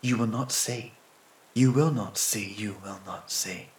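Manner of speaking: hushed, eerie echo; bushes whispering back. A narrator's reading. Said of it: "answers the bushes on the other side".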